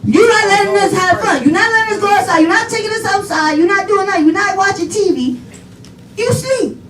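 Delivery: high-pitched run of acted grievances; hammed-up childish back talk; defiant complaint.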